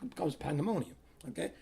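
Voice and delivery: ordinary talk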